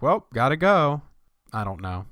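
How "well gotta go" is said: hasty exit voice. Retold or imitated. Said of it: "they probably just were like".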